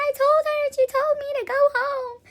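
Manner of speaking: very high, loud drawn-out wail; mock crying. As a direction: wail it like an upset kid to mom. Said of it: "sitting here crying".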